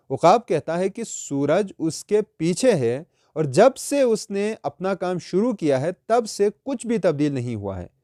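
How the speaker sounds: normal talking voice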